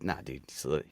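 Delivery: low, hushed brush-off; casual dismissal. Retold or imitated. Doctor to worried patient, low casual dismissal. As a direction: wave it away softly, voice dropped.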